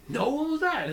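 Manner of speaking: squeaky, cartoonish retold answer; high mock reply. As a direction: play yourself surprised in a high silly voice.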